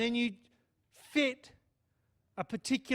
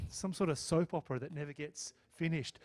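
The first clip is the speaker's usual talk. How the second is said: ordinary talk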